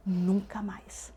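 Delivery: softly, drawn-out "Nunca mais"; hushed emphasis. Real, not acted. Telling of never being left alone, hushed emphasis. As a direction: let "Nunca mais" sink to a slow near-whisper. Solemn repeat of never again.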